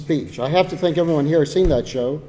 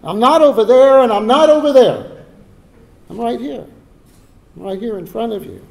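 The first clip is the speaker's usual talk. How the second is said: imagined king's plea, higher and louder, easing off by the end; king's inner plea; 8 semitones higher, 10 dB louder than usual. Retold or imitated. King George to his restless audience, half-acted urgent plea. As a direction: urge the fidgeting crowd to look right at you. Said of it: "the king was thinking".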